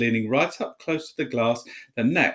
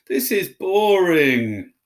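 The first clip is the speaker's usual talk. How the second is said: high, loud, slow moan; moaning Dudley; 7 semitones higher, 7 dB louder, much slower than usual. A narrator's reading. Dudley moaning at the sleeping snake. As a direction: whine it out, bored and sulky. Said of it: "Dudley moaned".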